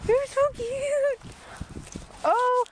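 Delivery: slow, high, drawn-out coo; slow high coo to the dog. Real, not acted. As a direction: stretch it out sweetly for the pet. Cooing at the dog.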